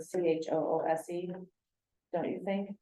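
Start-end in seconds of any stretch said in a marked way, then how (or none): 0.0-1.3 spelling out "C H O O S E" letter by letter; letters of "C H O O S E" read one by one; spelled out. Shown as a demonstration. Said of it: "choose would be a better word".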